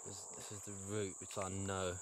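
quiet, careful whisper-like voice; hushed voice. Real, not acted. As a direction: keep it hushed so nothing hears. Hushed, hearing something in the dark.

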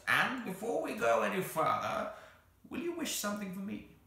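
high, thin creature voice; pleading creature. Acted. The Psammead asks the children for a favour, pleading.

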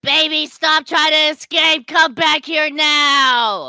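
loud, drawn-out mock command; hammed-up angry chase shout; villainous yell. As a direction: bellow slowly after someone escaping. Villain yells after escapee.

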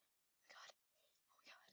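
breathless frightened whisper; whispered oh my god in suspense; scared whisper. Real, not acted. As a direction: hush it so nobody hears. Sneaking past, afraid of being seen.

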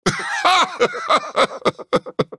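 long, very high staccato laugh; performed game character laughter; high cackling laugh. Acted. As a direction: cackle in a rapid high rattle.

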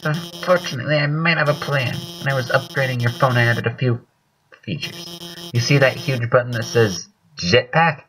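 high-pitched, worried helper voice; anxious game character. Acted. Helper on the phone revealing a jetpack, anxious high voice.

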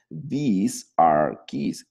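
slow model sentence. Shown as a demonstration. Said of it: "slow model sentence".

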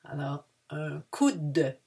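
"un coude" slow again, "coude" long; unhurried "coude"; slow repeat. Shown as a demonstration. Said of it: "not to mistake with le cou".

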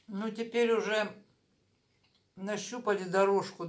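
chewing while talking; speaking between spoonfuls; mouth full. Real, not acted.

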